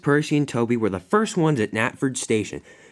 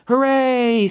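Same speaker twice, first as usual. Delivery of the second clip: high, loud celebratory cheer; 13 semitones higher, 6 dB louder than usual. A narrator's reading. Engines cheering their friend's win, high and loud. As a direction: let out a big high hooray.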